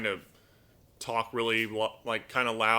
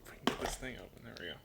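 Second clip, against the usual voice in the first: whisper; 7 dB quieter, much faster than usual. Real, not acted. Opening treats beside the bird.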